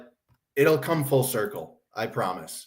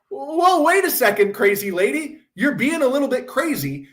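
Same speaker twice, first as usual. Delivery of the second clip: higher, mock-calm pushback; mock reasonable archaeologist; 10 semitones higher than usual. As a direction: imitate a sane scholar calling her out, lightly. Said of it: "None of these archaeologists go".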